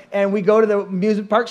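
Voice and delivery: ordinary talk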